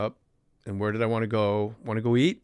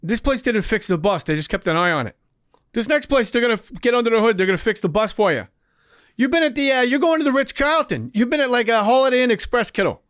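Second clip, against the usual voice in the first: much higher, fast, pushy imitation of Chappie; Chappie impression; 9 semitones higher, 3 dB louder than usual. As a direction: sell him rehab like a used car. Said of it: "the Boston guy, goes".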